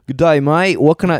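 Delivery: botched British that comes out Australian; Australian accent. Retold or imitated. Imitating a front-counter guy, slipping into an Australian accent. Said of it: "nope, that's Australian".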